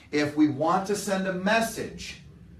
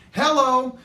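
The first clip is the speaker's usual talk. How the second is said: much higher, loud thrilled hello; excited hello; 10 semitones higher, 8 dB louder than usual. Retold or imitated. Grown daughter answering the phone, bursting with excitement. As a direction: greet the caller with real joy. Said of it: "Miss Excitement".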